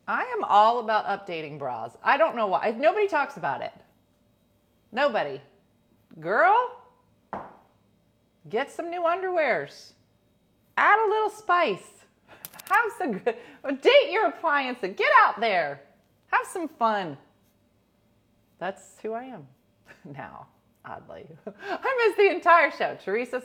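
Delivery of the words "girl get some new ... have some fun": put-on high, loud sassy girlfriend hype; cheeky best-friend pep talk. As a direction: hype up the girls with sass.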